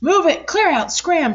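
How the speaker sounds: high, loud barked orders; bossy shout. A narrator's reading. Duck shouting the pigs out of his water hole. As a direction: chase them off, yelling. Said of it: "declared the duck".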